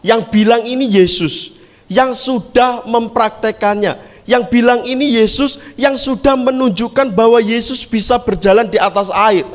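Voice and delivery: ordinary talk, nothing marked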